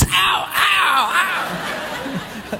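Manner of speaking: yelping ow ow, trailing into a laugh; mock cries of pain. Kid grabbed from under the bed, mock yelps of pain. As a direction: play the kid grabbed by the legs. Mom attacks their legs.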